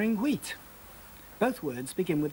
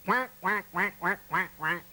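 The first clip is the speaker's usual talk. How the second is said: light playful quack; duck quacking. Pretend. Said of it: "And goats".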